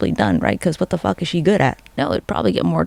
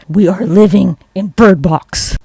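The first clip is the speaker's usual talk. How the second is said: slow, louder proclamation; dramatic declaration; 6 dB louder, slower than usual. Retold or imitated. Sharing her hot take about Bird Box, slow and loud. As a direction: drag it out like a big reveal. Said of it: "my hot take was like".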